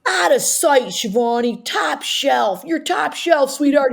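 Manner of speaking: high, exuberant imitation of dad; proud, excited dad. Father praising daughter on her SNL news, exuberant.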